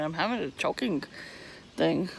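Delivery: ordinary talk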